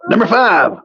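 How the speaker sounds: ordinary talk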